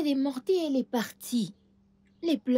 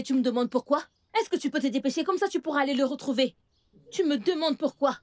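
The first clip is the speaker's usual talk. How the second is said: angry scolding; 4 semitones higher than usual. Acted. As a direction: snap at the niece for asking why. Aunt scolds niece angrily.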